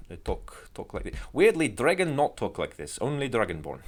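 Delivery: put-on Slavic Dragonborn accent. Shown as a demonstration. Game master showing how Dragonborn talk, mock Slavic accent. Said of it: "That's Slavic Dragonborn".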